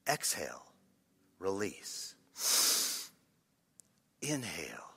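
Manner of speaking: low, soft breathing cue; guided breathing. Shown as a demonstration. Preacher leading a breathing exercise, low meditative voice. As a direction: coach the breath quietly, with pauses.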